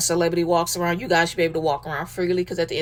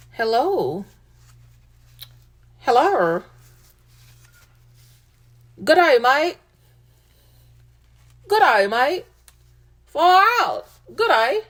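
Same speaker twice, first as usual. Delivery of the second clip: a hammed-up Australian accent, higher and louder; 6 semitones higher, 4 dB louder than usual. Fan greets Chris like an Aussie mate, in a mock Australian accent. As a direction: play the Aussie mate for Chris, bright and over the top.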